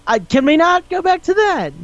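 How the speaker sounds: very high, squeaky mock objection; hammed-up high-pitched embarrassment; embarrassed protest. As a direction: act out a mortified plea, voice shooting way up. Acted embarrassed objection to old incident.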